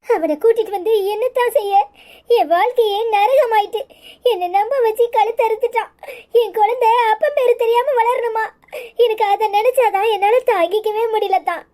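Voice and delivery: sobbing lament with gasping breaths; tearful despair; crying. Acted. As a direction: weep helplessly between phrases. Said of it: "அழாதம்மா".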